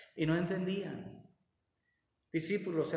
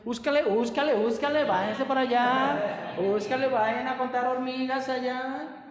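louder, higher, dismissive shoo; impatient shooing; 5 semitones higher, 5 dB louder than usual. Acted. Disciples shooing children away, loud and dismissive. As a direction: brush the kids off impatiently.

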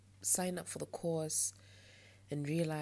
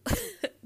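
a sudden high squeal of a laugh, louder; high burst of laughter; 10 dB louder than usual. Real, not acted. Vlogger laughing at her own frustration, high burst of laughter.

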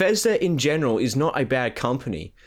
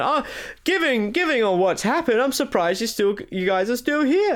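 mocking Todd on stage; 11 semitones higher than usual. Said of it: "Todd Coward comes out on stage saying".

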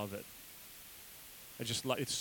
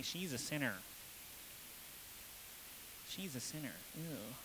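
thin, nasal sneer of disgust; nasally voice; 11 dB quieter than usual. Shown as a demonstration. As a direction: pinch it through the nose with a sneer. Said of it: "in a real nasally voice say".

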